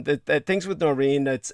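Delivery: ordinary talk, nothing marked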